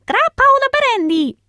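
a keen dog asking about drinks; eager dog. Acted. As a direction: ask hopefully.

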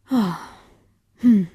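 ordinary delivery, nothing marked

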